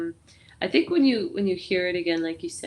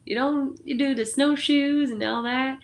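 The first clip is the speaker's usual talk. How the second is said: incredulous Southern voice; 7 semitones higher than usual. Retold or imitated. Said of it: "And I'm like".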